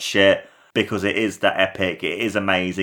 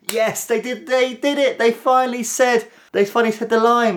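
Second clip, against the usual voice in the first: very high, squealed fanboy glee; reliving a fan's high-pitched excitement; excited fan squeal. Retold or imitated. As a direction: squeak it out in falsetto, thrilled. Acting out first hearing the line.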